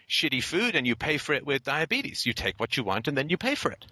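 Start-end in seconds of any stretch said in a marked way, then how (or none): none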